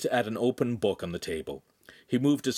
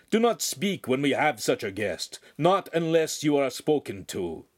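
higher, mildly stern scolding; 6 semitones higher, 4 dB louder than usual. A narrator's reading. Master scolds apprentices before his guest, sternly. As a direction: lay down the rule firmly. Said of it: "the master said sternly".

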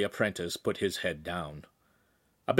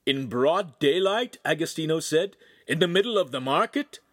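shocked; 7 semitones higher, 5 dB louder than usual. A narrator's reading. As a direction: gasp in disbelief. Shocked at the attack.

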